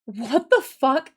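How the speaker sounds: slow, stretched-out mock disbelief; imitated slow, puzzled reaction; imitated bafflement. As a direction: draw it out as a stunned coworker would. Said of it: "And she was like".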